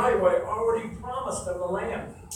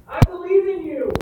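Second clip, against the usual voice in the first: louder promise; 7 semitones higher, 7 dB louder than usual. Real, not acted. Repeated promise peaks.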